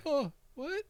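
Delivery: high-pitched mock disbelief; shocked kid. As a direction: imitate a kid stunned by the jab. Retold child's shock at prick.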